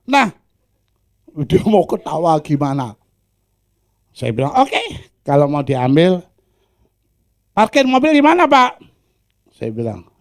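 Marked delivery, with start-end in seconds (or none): 4.6-8.8 loud question. Acted. Preacher asking collector.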